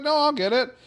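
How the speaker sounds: high, loud play-acted boy calling back; heedless eager kid. Wesley runs on after the ball, eager.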